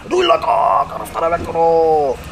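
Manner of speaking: put-on very high, slow Japanese-accented English; mock Japanese accent. The bartender repeats his question, high and in a mock Japanese accent. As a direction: squeal the question, then slow down.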